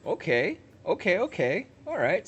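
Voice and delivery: high, loud and hyped; excited. Real, not acted. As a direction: ride the adrenaline. Still hyped after whoa.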